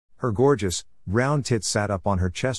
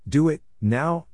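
stern command; 6 semitones higher, slower than usual. A narrator's reading. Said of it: "I demanded sternly".

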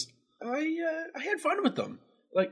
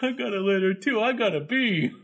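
a lightly voiced proud simpleton; dopey Higgins; 2 semitones higher, 3 dB louder than usual. Co-host echoes the dumb Higgins voice he loved. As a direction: repeat it as the dim hero showing off. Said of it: "It was so bad I made you laugh".

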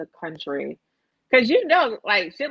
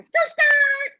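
high, loud mock skid noise; mimicked tire screech; 7 dB louder than usual. Telling friends how the van pulled up, loud high screech. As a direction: screech it like skidding tires.

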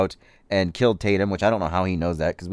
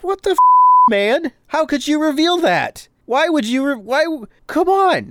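much higher and louder, exasperated protest; mock outrage; 6 dB louder than usual. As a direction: squeal it out like a betrayed fan, spoiler ruined. Outraged at teacher's spoiler.

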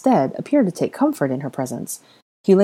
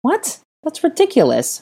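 high, incredulous scoff; 12 semitones higher, 3 dB louder than usual. Acted. Hermione told the child is hers, scoffing in disbelief. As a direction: scoff at the absurd idea. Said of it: "Hermione scoffed".

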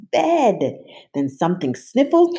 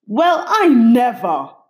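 higher, louder huffy monster; offended sniffle; 7 semitones higher, 7 dB louder than usual. A narrator's reading. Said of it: "she sniffled".

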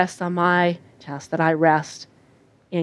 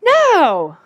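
high, louder defiant no; firm toddler no; 9 semitones higher, 5 dB louder than usual. Retold or imitated. Toddler asserting herself, high defiant no. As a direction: plant your feet and refuse. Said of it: "But she's not really going".

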